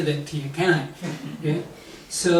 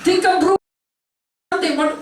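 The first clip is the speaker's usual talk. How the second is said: much higher, frantic thinking aloud; panicked inner voice; 13 semitones higher, much faster than usual. Retold or imitated. Panicking narrator coaching himself, much higher and frantic. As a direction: scramble for words, pitched high.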